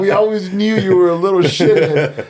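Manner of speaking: high, loud principal impression through laughter; laughing, hammed-up put-down; school principal. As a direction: crack up while voicing the principal's insult. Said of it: "the dean and the principal was like".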